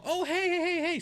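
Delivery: high-pitched, singsong mock hellos; mock greeting. Ignored man mimicking his date greeting others, mock gushing. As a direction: imitate someone greeting a roomful of friends.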